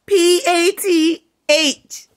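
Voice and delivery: P A T H spelled letter by letter, slow; spelling it out. Shown as a demonstration. Ranting about rivals, she spells P A T H out letter by letter.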